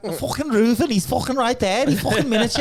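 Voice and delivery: very high, loud Scouse lad; over-the-top mock Scouse; Scouse accent impression. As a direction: crank up a throaty, excitable Scouse. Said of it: "the fucking Scouse lad".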